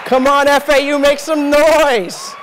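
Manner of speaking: loud, high call to the crowd; crowd hype shout. Real, not acted. Speaker firing up the FAU crowd, loud shout. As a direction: pump up the audience, shouting.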